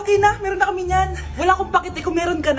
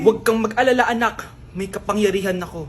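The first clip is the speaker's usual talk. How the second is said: lower, confident hero voice; mock heroic mother; 7 semitones lower than usual. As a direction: play it like a hammed-up superhero. Mother reveals her superpower. (kunwaring bayaning nanay)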